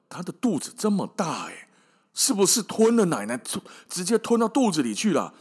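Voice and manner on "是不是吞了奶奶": ordinary delivery, nothing marked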